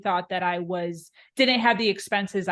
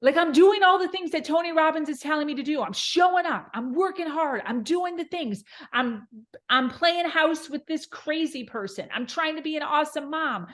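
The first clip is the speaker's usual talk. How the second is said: frantic retold rant; 7 semitones higher than usual. Retold despair in the car.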